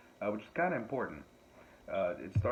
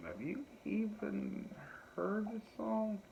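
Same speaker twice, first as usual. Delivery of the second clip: incredulous; 12 semitones higher, 5 dB quieter, slower than usual. Retold or imitated. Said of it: "I'm like".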